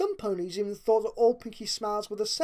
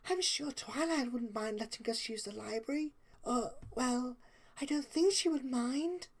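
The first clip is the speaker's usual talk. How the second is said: softer, high and gentle; Fluttershy's voice; 4 semitones higher, 6 dB quieter than usual. A narrator's reading. Fluttershy softly praising Rarity's plan. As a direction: speak it timidly, eager to please. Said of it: "she amended, her smile failing".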